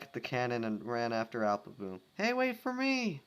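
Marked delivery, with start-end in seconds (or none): 2.1-3.2 high, loud plea to wait; panicked shout; desperate call. Acted. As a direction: shout after her as you run. Sweetie running after.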